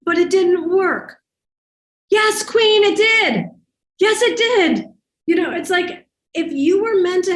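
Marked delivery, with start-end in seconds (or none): 0.0-1.2 slow, high, deflated whine; drawn-out mock complaint, pitched up; mock disappointment. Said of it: "And they'll be like". 2.1-4.9 much higher and louder, hammering it home; bright, pitched-up yes queen; emphatic insistence. Real, not acted. Coach insisting to disappointed client.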